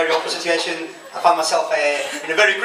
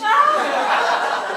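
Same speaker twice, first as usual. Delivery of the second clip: much higher, very slow, laughing; laughing build-up. Real, not acted. Comic recalling being forgotten, high drawn-out laugh. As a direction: drag it out through laughter.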